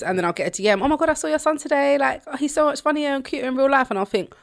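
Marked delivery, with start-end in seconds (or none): 0.8-3.9 high, sing-song mock gushing; pitched-up fangirl imitation; imitated gushing fan. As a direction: coo like an over-keen admirer. Voicing a gushing message about son.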